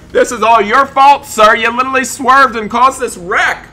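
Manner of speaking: high, loud indignant shouting; angry accusation. Pretend. Driver blames the sir for this wreck, angry accusation. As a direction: lay into the other driver in play fury.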